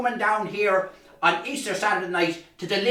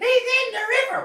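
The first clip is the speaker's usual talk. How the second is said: high, breathless alarm; high, urgent kid calling for help; urgent child; faster than usual. Acted. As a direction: rush it out like a panicking boy. Boy runs for help.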